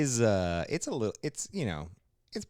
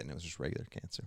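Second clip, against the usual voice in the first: whisper; 6 semitones lower, 13 dB quieter, much faster than usual. Real, not acted. Deadpan punchline whisper.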